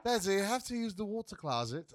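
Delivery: mock British child; British accent. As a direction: imitate your kid being proper in British. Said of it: "with a fucking British accent".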